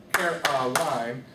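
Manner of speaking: Caroline slowly broken into three even syllables. Shown as a demonstration. Music teacher showing how students clap syllables, syllable by syllable. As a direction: clap it out, CA-RO-LINE. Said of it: "clap out syllables of words".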